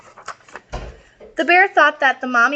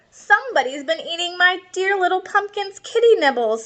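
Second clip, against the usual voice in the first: higher voice of an offended mother; fussy mom voice; 7 semitones higher than usual. Acted. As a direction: play a fussing mum. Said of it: "said the mommy person".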